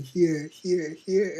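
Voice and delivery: high, loud repeated calls; excited calling out. Acted. The soul calls as the person keeps following, excited calling out.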